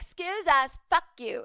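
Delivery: high, loud prissy "excuse us"; mock entitled woman. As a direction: parody a haughty lady.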